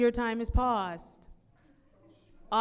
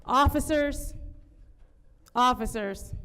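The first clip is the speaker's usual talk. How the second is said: raised, summoning call to officers. Real, not acted. Chair summons officers over a disruptive audience, calling out. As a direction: call out firmly for attention.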